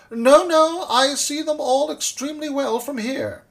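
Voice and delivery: high, loud wail of a panicking dentist; acted high-pitched desperate protest; despairing cry. A narrator's reading. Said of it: "weeping in despair, he cried".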